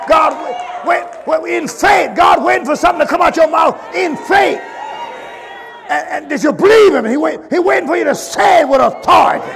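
loud, high preacher's cry; preaching at full force. Acted. Preacher urging the church, at full force. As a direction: drive the exhortation home like a pulpit call.

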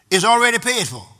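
loud, high preacher's proclamation; full-force preaching. Real, not acted.

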